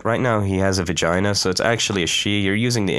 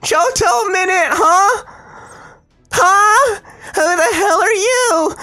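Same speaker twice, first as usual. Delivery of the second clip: higher, louder voice of a raging knight; a cornered knight working herself into fury, higher and louder; furious Mordred; 3 dB louder than usual. Acted. Streamer voicing the knight as she threatens the heroes, higher and louder.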